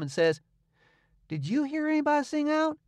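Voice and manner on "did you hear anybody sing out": put-on higher boy's voice, coolly innocent; perfectly calm. A narrator's reading. Tom turning calmly to Huck to ask. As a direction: ask it as if nothing happened. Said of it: "perfectly calm".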